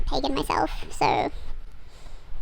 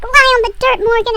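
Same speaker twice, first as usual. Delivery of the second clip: high, loud dramatic order; urgent mock command; 9 semitones higher, 8 dB louder than usual. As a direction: act out an urgent instruction. Boy orders panicking girl down.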